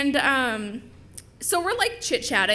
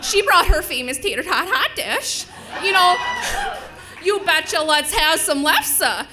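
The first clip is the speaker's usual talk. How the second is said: loud, higher, hammed-up Minnesotan; Minnesota accent; 5 semitones higher, 7 dB louder than usual. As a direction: belt out a comic Minnesota accent through amusement. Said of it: "it does exist".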